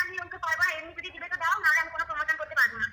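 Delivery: usual speaking voice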